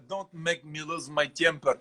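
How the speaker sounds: Russian accent. Shown as a demonstration. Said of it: "l'accent russe".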